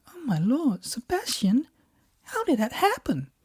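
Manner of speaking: very high-pitched wife voice; voiced shocked wife. Wife replies on the phone.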